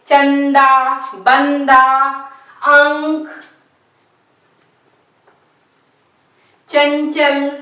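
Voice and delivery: चंदा, बंदा, अंक, चंचल with full nasal consonants. Shown as a demonstration. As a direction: sound the dot in चंदा, बंदा, अंक, चंचल through the nose. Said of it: "नाक से होता है।".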